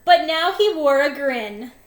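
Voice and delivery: usual speaking voice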